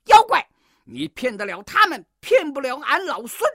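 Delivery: high, loud heroic challenge; defiant shout. A narrator's reading. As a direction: call out the demon boldly. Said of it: "叫道". (孙悟空高声叫阵)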